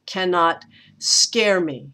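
"cannot" stressed on "can", then "scare" leaned on, slow. Shown as a demonstration. Teacher demonstrating the trochee to her students. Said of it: "which is a trochee".

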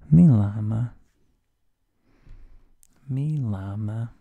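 "Mi llama" called out with a sounded L; hard L pronunciation. Shown as a demonstration. Teacher has class repeat, loud "llama" with an English L. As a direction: lead a repeat of "llama", said like the animal. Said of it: "say it with me".

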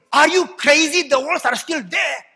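loud, high jeering at a believer; mocking shout. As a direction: yell it like scornful onlookers.

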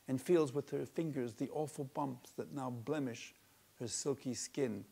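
usual speaking voice

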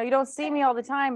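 ordinary talk, nothing marked

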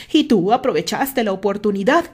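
loud angry outburst; shouting. A narrator's reading. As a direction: yell the accusation. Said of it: "gritó Terry".

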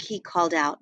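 usual speaking voice

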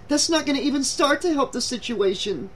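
high, exasperated protest; shrieking. A narrator's reading. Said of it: "Julie shrieked".

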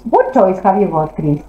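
ordinary delivery

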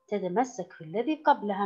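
normal talking voice

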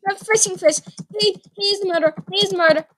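ordinary talk

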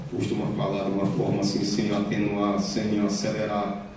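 low monotone, no rise or fall; demonstrating a level, unmodulated delivery; monotone speech. Shown as a demonstration. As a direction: drone evenly, never varying pitch or pace. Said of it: "uma fala monótona".

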